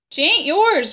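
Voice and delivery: high, loud, furious cry; scream. A narrator's reading. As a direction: scream it at the father. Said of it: "Bryce screamed".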